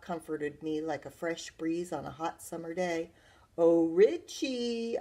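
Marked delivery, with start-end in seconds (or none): 3.6-5.0 slow, higher, drawn-out coo. A narrator's reading. Sister luring her brother by the rhubarb, cooing. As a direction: coo the name sweetly, stretching it out. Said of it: "I cooed".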